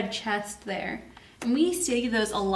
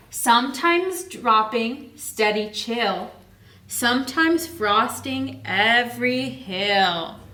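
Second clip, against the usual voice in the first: slow, lilting rhyme reading; read-aloud storytime; slower than usual. Acted. Teacher reads the rhyme to her class, slow and lilting. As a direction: savour each line for the kids. Reads rhyming book text.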